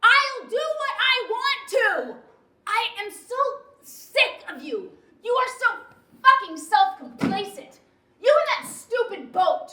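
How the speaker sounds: high, loud, emphatic rage; furious outburst. Acted. Wife fed up with self-complacent husband, furious outburst. Wife exploding in marital quarrel.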